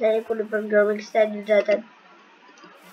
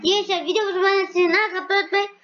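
youtuber outro; 6 semitones higher, faster than usual. Acted. Outro line.

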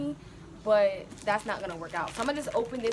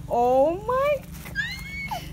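very high, loud, stretched-out shriek; drawn-out excited shout; 5 dB louder, much slower than usual. Real, not acted. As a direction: scream it slowly in pure delight. Opens free gift, thrilled.

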